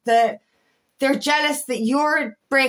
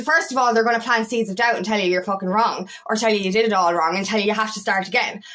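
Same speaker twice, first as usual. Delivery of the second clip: ordinary talk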